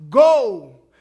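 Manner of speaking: loud, forceful Go; the Lord commanding the healed man, loud. Acted. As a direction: call out Go like an order. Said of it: "this command by the Lord himself".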